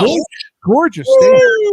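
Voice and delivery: high, loud, gushing praise. Real, not acted. Guest raving to the hosts about Pittsburgh's stadium, high and loud.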